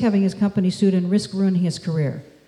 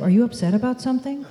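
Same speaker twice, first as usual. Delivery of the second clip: higher pitch, climbing through to "something"; rising question ending; 5 semitones higher, faster than usual. Shown as a demonstration. Speaker contrasts the question to avoid, rising ending on "something". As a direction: lift the question upward at the end on "something". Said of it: "Instead of".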